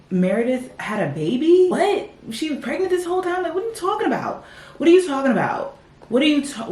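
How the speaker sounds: high, incredulous what; stunned and baffled; squeaky disbelief at the plot; disbelieving shock. Real, not acted. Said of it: "I'm so confused".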